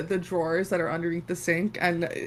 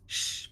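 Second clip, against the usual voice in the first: soft hushing "shh"; shushing; 9 dB quieter than usual. Acted. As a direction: shush the patient gently. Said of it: "puts a finger to your lips".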